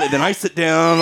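ordinary talk, nothing marked

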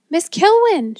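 high, loud shriek of a name; panicked shriek. A narrator's reading. Man calling his colleague as a dragon looms, panicked shriek. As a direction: shriek for help as the character. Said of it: "shrieked Hackenpfeffer".

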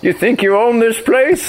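put-on high, cranky old-timer scolding teens; grumpy old man. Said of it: "channel my inner near thirty-year-old".

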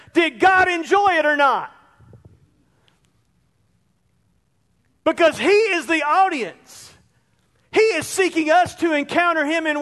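preaching shout. Real, not acted. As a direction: call it out from the pulpit. Preacher's climactic challenge.

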